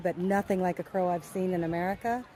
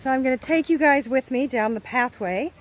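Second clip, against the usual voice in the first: loud, bright outdoor excitement; thrilled to show off the lagoon; loud delighted tour-guide voice; excited; 3 semitones higher, 5 dB louder than usual. Real, not acted.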